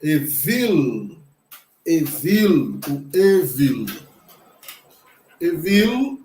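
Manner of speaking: Evil said e-VIL, stress on the last syllable; Hebrew pronunciation. Shown as a demonstration. Teacher sounds out the Hebrew word Evil for students. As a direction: model Evil for the class. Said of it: "nós temos aqui o".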